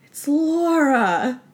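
slow, halting answer with a long pause; retold slow dawning of a name; drawn-out recollection. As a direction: draw out the first name, pause, then land the surname. Retold character struggles to recall a name.

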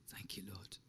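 breathy whisper; soft whisper to God; whispered prayer. Real, not acted. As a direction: pray under the breath. Quiet prayer during reflection.

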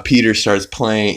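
ordinary delivery

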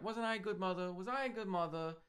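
mock the needy mother fishing for praise; a light take on an aging mother begging to be called good; imitating Big Edie. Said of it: "I'll try not to do the same voice".

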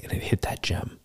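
a dramatic whispered reveal; hushed whisper. Acted. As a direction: whisper it, breathless with suspense. Dramatic moment in battle story.

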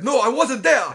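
high, loud comic protest; mock shouted retort. Joking about the elevator scene, mock shouted retort. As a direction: shout back in hammed-up protest. Acted retort in invented dialogue.

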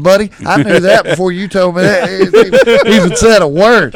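laughing through the jab; laughing retort. Retold or imitated. Coach teases a father, laughing. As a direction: laugh as you land the put-down.

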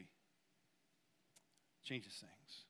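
hushed, slow, almost breathless. Real, not acted. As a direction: let it drop to a near whisper. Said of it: "emotional sermon moment".

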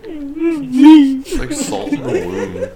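high squealing laugh-cry, slow. Real, not acted. Teasing friend, laughing.

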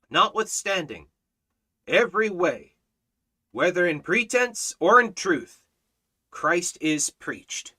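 preached scripture reading. Acted. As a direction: proclaim the verse to the congregation. Reads scripture at full force.

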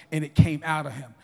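normal talking voice

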